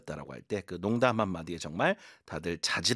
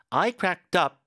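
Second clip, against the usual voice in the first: I said higher and louder, then cracked up; stress on I; 8 semitones higher, 4 dB louder, much slower than usual. Shown as a demonstration. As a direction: punch the word I at the start. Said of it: "이렇게 힘 주시고".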